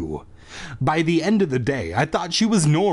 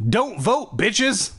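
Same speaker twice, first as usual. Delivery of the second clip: mock rallying shout; 8 semitones higher, much slower than usual.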